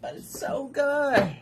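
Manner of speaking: slow, high, pleading comeback; hammed-up whine; mock whiny protest. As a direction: drag it out like a helpless plea. Acted protest to her own body.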